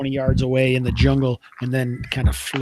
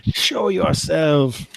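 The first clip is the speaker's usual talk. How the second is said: drawn-out mock holler at table volume; slow, restrained pretend yell; 3 semitones higher, much slower than usual. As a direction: stretch it out like a far-carrying call. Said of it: "you know, yells out".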